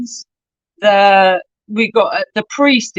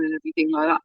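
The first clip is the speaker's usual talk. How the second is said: usual speaking voice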